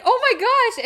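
high, loud amazed squeal; acting out an overwhelmed reaction; stunned gasp. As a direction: squeal it as he appears. Acts heroine seeing Henry arrive.

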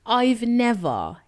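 loud laughing disbelief, slow start; real incredulous laughter. Real, not acted. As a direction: laugh it out, still stunned. Laughing at sugarless cake.

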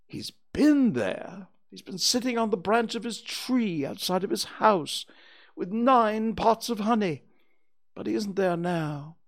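high, loud owl character voice; Owl reporting back. Acted. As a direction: deliver the news as a self-important owl.

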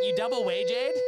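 high, loud laughing jab; laughing squeal. Real, not acted. Mock hype, laughing.

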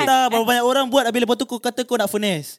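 high, loud mock scolding rant. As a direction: berate them in playful fury. Mock scolding friends about finessing.

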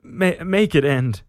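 high, loud agonized begging; acted pleading in pain, short of screaming; stammered plea. A narrator's reading. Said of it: "murmured Andreas".

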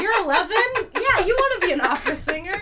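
high, loud coaxing grown-up; mock teacher voice. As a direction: tease like an adult asking a kid. Mocking asking a child.

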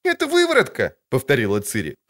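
put-on a sharp, high girlish retort; girl's voice. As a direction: contradict him like a cheeky girl. Ciri voice.

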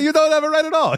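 high-pitched mock protesting Englishman; Zack Sabre Junior. Wrestler barging in on his imitator, mock indignant. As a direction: squeal the complaint in a put-on voice. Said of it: "Sabre channeled me".

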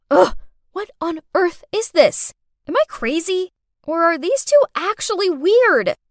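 exasperated disbelief. A narrator's reading. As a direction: vent in baffled frustration. Narrator baffled and fed up.

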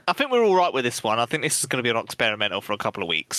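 ordinary talk

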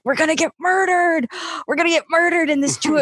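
high-pitched mock panic; retold scared. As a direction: imitate a frightened warning to mom. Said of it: "I got really scared then".

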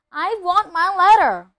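high, loud, insistent shout. A narrator's reading. Nephew refusing to move without his letter, shouting. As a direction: shout your demand. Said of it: "he shouted".

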